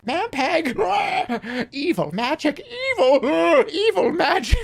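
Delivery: very high bird voice broken by squawk-laughs; high hawk voice with hiccuping cackles. Acted. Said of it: "somebody that has the hiccups really bad".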